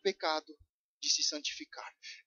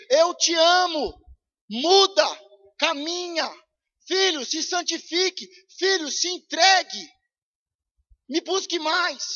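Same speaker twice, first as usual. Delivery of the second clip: raised, pleading call as God speaking to believers; 6 semitones higher, 5 dB louder than usual. Acted. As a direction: speak as God calling his children, a touch louder. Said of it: "É Deus falando com os crentes".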